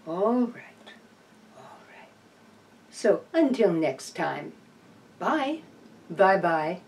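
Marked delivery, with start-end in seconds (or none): 1.6-2.1 whisper. Real, not acted. Whisper to possum.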